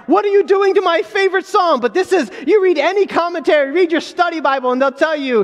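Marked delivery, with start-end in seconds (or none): 0.1-1.8 a hammed-up protest, pitched higher; mock dismay. Pastor voicing a listener upset over their favorite psalm, mock dismay. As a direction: play the startled churchgoer. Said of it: "this is like".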